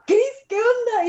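high, half-laughing alarmed call; mock panic with a crack of laughter; retold alarm. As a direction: replay the shock of finding her by the fire. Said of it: "Y yo llego y le digo".

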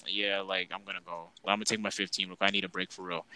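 quietly excusing himself for a break; low, soft, done-with-it aside; tired, fed-up mumble. Retold or imitated. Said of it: "I was just like".

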